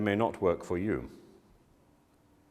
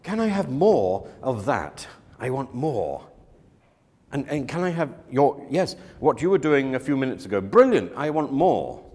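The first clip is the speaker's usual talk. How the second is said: 1 enthusiastic encouragement; 5 semitones higher, 5 dB louder than usual. Retold or imitated. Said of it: "And you say".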